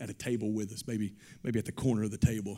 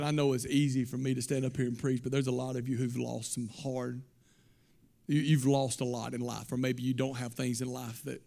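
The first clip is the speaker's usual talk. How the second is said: ordinary talk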